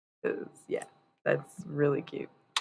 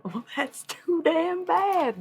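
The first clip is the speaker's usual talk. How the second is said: mock retort; 12 semitones higher, much slower than usual. Mocking retort to grandpa.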